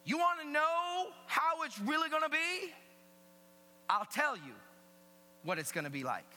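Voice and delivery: high, loud, driving sermon delivery; full-force preaching. Acted. Preacher relaying Jesus's warning to the congregation, emphatic shout.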